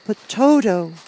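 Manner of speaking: put-on high-pitched worried child; anxious little girl. A narrator's reading. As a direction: plead anxiously in a small girl's voice. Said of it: "said the girl anxiously".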